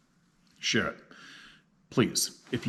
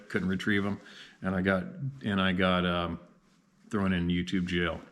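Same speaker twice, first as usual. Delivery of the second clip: ordinary talk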